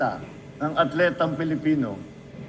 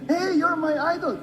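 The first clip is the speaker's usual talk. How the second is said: high, bright-eyed hey you're my idol; excited fan greeting; 11 semitones higher than usual. Retold or imitated. Manny greeting the Celtics captain, starstruck. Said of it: "sabi mo".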